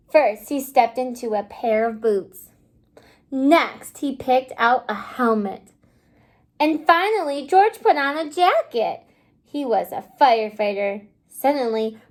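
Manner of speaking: put-on lively read-aloud storybook voice for little kids; reading to children. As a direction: read the picture book to the class, bright and clear. Teacher reads picture book aloud.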